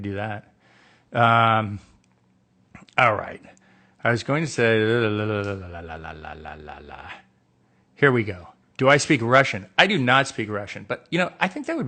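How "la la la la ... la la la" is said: descending la-la babble. Real, not acted. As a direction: run of la-las sliding down and fading. Babbles a falling run of la.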